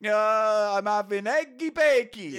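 put-on high, silly cod-accented pontiff; mock Pope. Pope telling what's for dinner, high comic accent. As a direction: garble it in a hammed-up foreign accent. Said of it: "So say the Pope".

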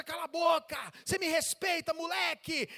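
loud, high table-banging scolding; angry father. Acted. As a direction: lay down the law to the boy. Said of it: "Aí o pai bate na mesa".

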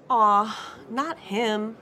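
mild whine of reluctance; complaining. A narrator's reading. Daughter told she must ask Benny, complaining. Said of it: "I complained".

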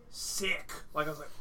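ordinary talk, nothing marked

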